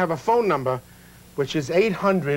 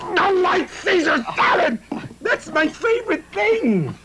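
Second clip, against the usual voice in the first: much higher, slow, overdone delight; mock gushing; 12 semitones higher, slower than usual. Host gushing over the chef's light Caesar salad. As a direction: swoon over the salad like a fan.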